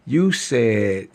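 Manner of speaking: ordinary talk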